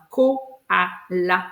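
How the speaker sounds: "koala" in three slow, separate beats; split into syllables. Shown as a demonstration. Teacher counting syllables with Madame Mo, each one separate. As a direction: break "koala" into ko, a, la. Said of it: "j'ai trois syllabes".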